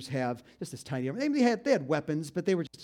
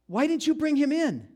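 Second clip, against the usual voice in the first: exasperated demand; 10 semitones higher, 6 dB louder than usual. Acted. Chief priests confront the guards.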